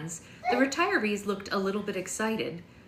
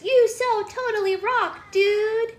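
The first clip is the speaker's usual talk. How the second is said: very high, loud, slow surfer-dude turtle; 14 semitones higher, 9 dB louder, much slower than usual. Acted. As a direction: squeal it like a stoked cartoon turtle. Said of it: "That turtle says".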